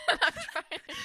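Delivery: words lost in high real laughter; giggling helplessly; laughing. Real, not acted. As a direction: laugh so hard the words barely come out. Laughing at friend's story.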